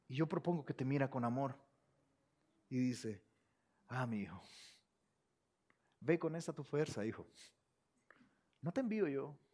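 soft, low and gentle; hushed, tender reassurance; tender Jesus. Acted. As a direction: speak like a loving father to his child.

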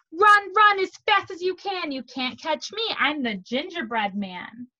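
high, loud start settling to normal pitch; sing-song storybook taunt; taunting gingerbread man. Acted.

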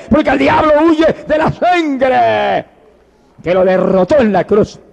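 high, straining altar call; fervent revival preaching at full pitch; impassioned preaching. Real, not acted. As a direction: cry it out to the whole congregation, building to a shout.

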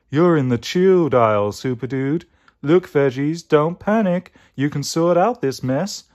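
cool-dude character voice; Mystic Mango. Acted. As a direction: reassure like a groovy hippie dude. Plays Mystic Mango, groovy guru.

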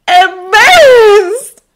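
loud, high, drawn-out amazement; hugely amazed, voice lifted. A narrator's reading. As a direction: stretch the word out in loud wonder. Narrator acts out the amazement.

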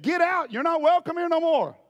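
high, loud, harsh order to leave; angry expulsion. Retold or imitated. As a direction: throw him out of the church. Church expels the healed man.

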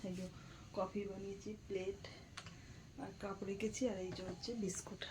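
hushed, very soft, near whisper; speaking softly. Real, not acted. Mother showing the laid-out snacks while child sleeps, speaking softly. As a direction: drop to a murmur so nobody wakes. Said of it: "আস্তে আস্তে কথা বলছি যাতে উঠে না যায়".

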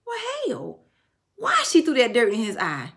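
high, startled "well, hell"; puzzled outburst. Retold or imitated. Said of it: "I was like".

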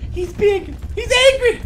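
high, loud, breathless panic; panicked exclaim. Real, not acted. Player warning friends about the angry bug, high panicked exclaim. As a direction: warn everyone in a frantic rush.

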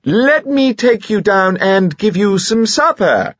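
high, slow, deliberate loud offer; shouting her down. Acted. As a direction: drown out a tactless talker with a loud suggestion. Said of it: "hurriedly shouted her down".

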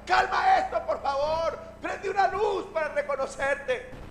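high, loud mock-panicked plea; hammed-up wail of fright; frightened. Disciple begs Jesus to calm the storm, hammed-up frightened plea. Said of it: "en medio del susto".

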